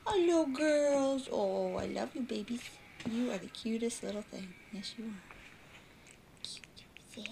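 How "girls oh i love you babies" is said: ordinary talk, nothing marked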